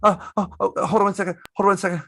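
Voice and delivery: flustered wife. Retold or imitated. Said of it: "and she's like".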